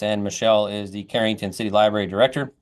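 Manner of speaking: normal talking voice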